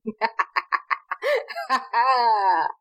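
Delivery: long burst of laughing; amused cackling laugh; real laughter. Real, not acted. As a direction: laugh helplessly at the act. Player laughs at the GM's character.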